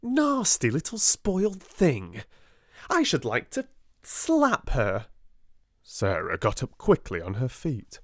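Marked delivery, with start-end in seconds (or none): 0.0-5.1 higher, sneering outburst; mock the spoiled child with contempt; spiteful girl. A narrator's reading. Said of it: "broke out Lavinia".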